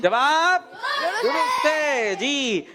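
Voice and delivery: high call, long drawn-out verdict; quiz show announcer. Real, not acted. Host confirming a child's answer, announcer style. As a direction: stretch it out to announce a winner.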